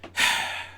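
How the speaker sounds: long soft breathy sigh out; deep sigh. Acted. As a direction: let the tension out in one deep sigh. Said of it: "he heaved a deep sigh".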